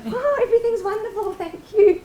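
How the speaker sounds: very high, sing-song cheerful greeting; put-on delighted phone manner; sudden sweet phone voice. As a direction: switch instantly to gushing, high-pitched politeness. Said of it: "immediately you can go".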